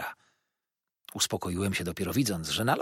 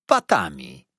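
low, loud, ominous word; dreadful answer; 7 semitones lower, 5 dB louder, slower than usual. A narrator's reading. Waiter revealing how ribs were broken, ominous. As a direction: drop it like a grim verdict. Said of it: "brzmiała straszliwa odpowiedź".

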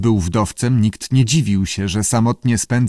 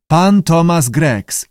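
drawn-out, raised model phrase; slow teaching prompt; 6 semitones higher, 4 dB louder, slower than usual. A narrator's reading. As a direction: say it slowly for a pupil. Keeper teaching lizard his name.

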